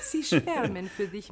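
langsam, mit Lachen in der Stimme; lachend langsam. Acted. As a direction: neckt ihn lachend. Gräfin neckt Elliot. (langsam und lachend, neckend)

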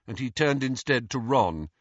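plain reading voice

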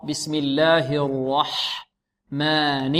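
very slow "الرحمن" with a full, heavy ر; emphatic velarized ر in "الرحمن", drawn out slowly. Shown as a demonstration. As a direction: stretch "الرحمن", thickening the ر. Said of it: "الراء في البسملة مفخمة الراء".

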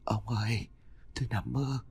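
a frightened wife on the verge of tears; tearful. Acted. Wife waking from a nightmare, telling husband, soft and tearful. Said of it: "bật lên thành tiếng khóc".

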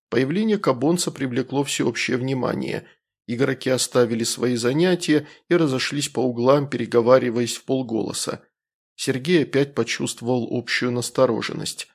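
plain reading voice

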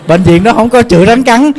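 laughing through the words at his own mistake; giggling while he corrects himself. Real, not acted.

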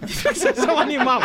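falar rindo; xingamento risonho. Real, not acted. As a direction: soltar o insulto entre risadas. Provoca o colega rindo da piada. (xingando o amigo às gargalhadas)

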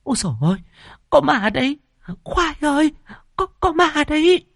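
very high-pitched panicked woman's cries; acted horror, voice thrown way up; terrified scream. A narrator's reading. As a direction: shriek for help, stammering in fright. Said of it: "mà kinh hãi hét lên".

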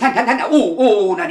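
loud, high frantic no; panicked refusal. Acted. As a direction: flail away from the cat in terror. Refuses to hold the cat.

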